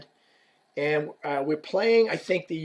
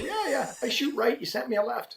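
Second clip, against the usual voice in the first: quoted player; 8 semitones higher, 5 dB quieter than usual. Retold or imitated. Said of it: "the guy goes".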